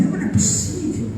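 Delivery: paciente reclamando. Retold or imitated. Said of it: "Às vezes fala assim".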